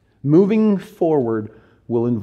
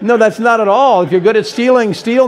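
raised, louder mock protest; mock shock; 7 semitones higher, 8 dB louder than usual. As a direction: play outraged at the misreading. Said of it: "No, no, no".

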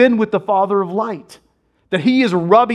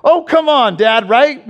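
higher, louder boy's mock protest; a kid echoing his dad's gripe; imitating his young son; 5 semitones higher, 4 dB louder than usual. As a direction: son Jack complaining to dad. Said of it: "So he's like".

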